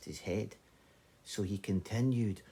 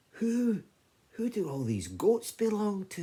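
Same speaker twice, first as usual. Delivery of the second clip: amazed question; 13 semitones higher, 5 dB louder than usual. Acted. Brother asks the goat herd.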